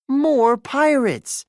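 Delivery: high, loud, slow alarm; boy yells at pirates at the window; yelling. Acted. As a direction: shout in alarm at intruders. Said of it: "John yells".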